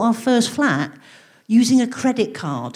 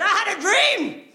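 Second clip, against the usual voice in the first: Martin Luther King parody; 9 semitones higher than usual. Pretend. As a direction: preach it like a great civil rights speech. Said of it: "if Martin Luther King ... Said".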